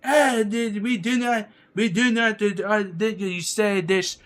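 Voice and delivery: high, loud, haughty mock-French protest; bad French accent. France scorning a suggestion, badly faked French accent. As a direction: stumble indignantly through a botched French accent. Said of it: "I can't do a French accent right now".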